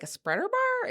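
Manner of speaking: high surprised question. Retold or imitated. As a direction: squeak it in surprise. Surprised guess in story.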